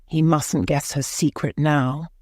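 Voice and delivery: ordinary delivery, nothing marked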